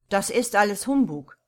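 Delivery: loud, emphatic dismissal; dismissive call. A narrator's reading. Washington Otis scoffing at the housekeeper's tale, loud dismissive call. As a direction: call it out, brushing the tale aside. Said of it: "rief Washington Otis".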